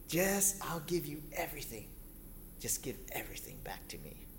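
devilish whispered bargain; sly whisper. Acted. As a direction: whisper the deal slyly.

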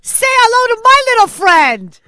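loud, high hammed-up mobster line; movie gangster quote.

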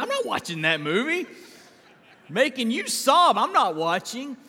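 imitated wife refusing. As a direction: play the wife laughing off the sad movie. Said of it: "She said".

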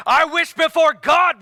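high, loud, drawn-out declaration; slow, ringing cry at full voice; bold proclamation. Acted. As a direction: proclaim it boldly as Paul. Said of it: "watch Paul".

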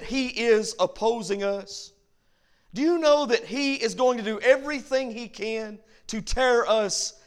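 rising, fervent pulpit voice; preaching. Acted. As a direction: build it up and drive it home to the church. Preacher builds back to exhortation.

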